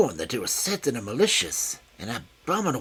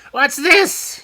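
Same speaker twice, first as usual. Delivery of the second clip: high, loud indignant cry; shrill outraged little man; high screaming voice; 13 semitones higher, 12 dB louder than usual. A narrator's reading. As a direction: shriek at the intruder. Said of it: "he cried in his high, screaming voice".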